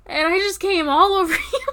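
fast giggling words; laughing. Acted. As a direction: laugh through the line. Laughing while speaking.

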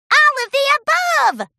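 high, loud joyful shout. Acted. Pinkie answering the fillies, high joyful shout. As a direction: shout it gleefully. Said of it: "shouted Pinkie".

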